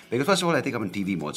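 announcer voice. Real, not acted. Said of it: "so I speak like the announcer".

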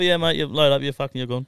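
high, loud brush-off; loud foreman voice. Retold or imitated. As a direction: boom it out like a foreman. Foreman quote repeated.

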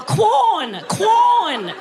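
mock desperate "Quorn". Shown as a demonstration. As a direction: beg with each "Quorn". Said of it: "It sounds so desperate".

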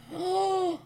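high, drawn-in gasp of awe; dramatic gasp. Acted. Dramatic gasp after battle retelling.

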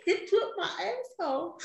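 high, laughing repetition. Retold or imitated. Retelling a dazed complaint to a friend, laughing high. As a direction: giggle out the line. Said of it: "Just kept saying it".

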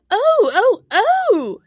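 high, loud wails of pain; shouting Queen. A narrator's reading. The Queen screams so loud Alice can't finish, shouting. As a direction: cry out shrilly as a hurt queen. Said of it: "shouted the Queen".

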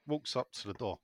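ordinary talk

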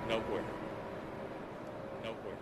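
repeated and dying away into silence; fading echo. Shown as a demonstration. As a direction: let it fade out like an echo.

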